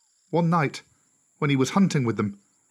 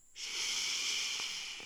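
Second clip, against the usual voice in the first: long, soft snake hiss; 14 dB quieter than usual. Acted. Old snake tripped over by Mowgli, long hiss. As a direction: hiss like a disturbed serpent.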